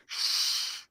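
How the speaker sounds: long hushing "shh"; imitated crowd shushing someone. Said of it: "everybody around me was like".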